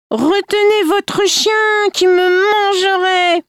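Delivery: put-on very high, sustained old-woman voice; vieille fée. A narrator's reading. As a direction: cry it out high, stretched like an old crone. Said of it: "La vieille lui dit". (voix très aiguë de vieille fée)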